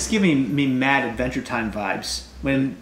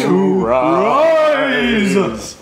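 slow dramatic read; 6 semitones higher, 5 dB louder than usual. Acted. Drawn-out dramatic recipe line.

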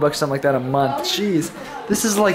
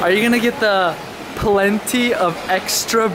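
ordinary talk

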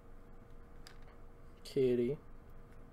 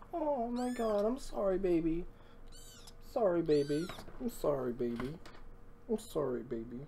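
much softer, fading sorry to the kitten; soft apology; 11 dB quieter than usual. Real, not acted. Apologizing to the dropped cat.

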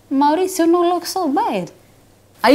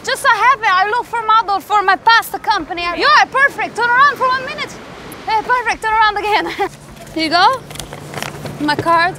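lightly put-on Italian accent; broken English with an Italian lilt; 4 semitones higher than usual. Italian pasta boss scouting a model, Italian accent.

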